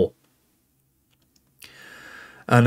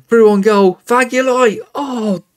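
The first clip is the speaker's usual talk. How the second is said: higher and louder yelling as he breaks through, then a cry of dismay; excited build to a groan; 10 semitones higher, 4 dB louder than usual. Real, not acted. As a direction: cheer him through on goal, then crumple as it's saved. Striker through on goal, then denied.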